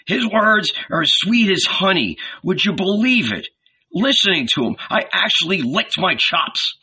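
high, lively, relishing voice; enthusiastic cobbler. A narrator's reading. Cobbler praises Jesus to his friend, enthusiastic.

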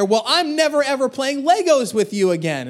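put-on high yell of a squabbling kid; mock child's shout. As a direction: imitate a sibling yelling back. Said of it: "And, and then I hear".

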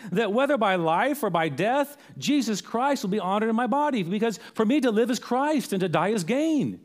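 high, fervent acted declaration; Paul. Apostle tells the Philippians he is ready to die, fervent. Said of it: "he'll tell the Philippians later on".

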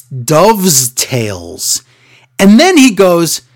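ordinary talk, nothing marked

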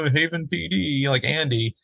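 hint of a naive local officer; small-town cop.

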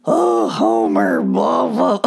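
a loud, slow cartoon mom voice; Marge impression. Laughing at herself, she slips into a loud cartoon wife voice. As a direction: play a fussy cartoon wife calling her husband. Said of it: "It sounds more like Marge".

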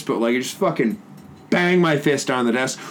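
ordinary delivery

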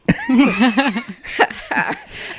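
short real laugh, rising; amused laugh. Real, not acted. Laughs at the lost campaign.

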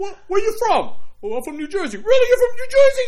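put-on a stranger caught off guard by his own accent; surprised stranger. Jersey stranger hears his accent copied, loud surprise.

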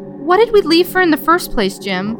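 a high confused wife. Acted. Wife voiced in story.

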